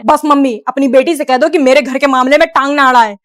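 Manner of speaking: loud, scolding mock husband; angry son. Son angrily scolds his mother to defend his wife.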